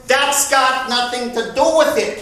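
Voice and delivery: loud sharp retort; shouted. Retold or imitated. Catherine snapping at her fiancé during the quarrel, shouted. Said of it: "Catherine shouted".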